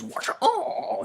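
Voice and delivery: spring the trick with a cartoon yell; mock battle cry. [name] playing possum then striking, hammed-up battle cry.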